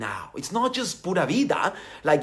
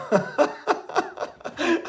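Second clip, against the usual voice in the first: laugh; 13 semitones higher than usual. Real, not acted. Laughs at own parody.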